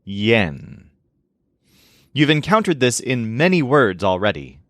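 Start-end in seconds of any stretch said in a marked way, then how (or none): 0.0-0.9 yen lower, as an isolated sound; careful demo of the syllable yen. Shown as a demonstration. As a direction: sound out yen with a clear y glide. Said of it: "the sound of the syllable".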